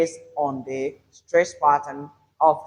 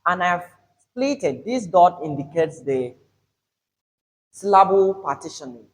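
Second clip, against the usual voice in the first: usual speaking voice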